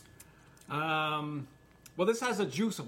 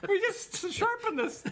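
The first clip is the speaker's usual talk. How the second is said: very high, slow squeal through laughter; helpless laughter; slower than usual. Real, not acted. Complaining about a freshly sharpened knife, helplessly laughing. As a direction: barely get the words out for laughing.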